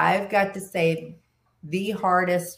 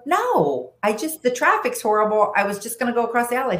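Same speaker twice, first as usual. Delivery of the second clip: defensive retold reply; 6 semitones higher, faster than usual. Retelling her phone reply.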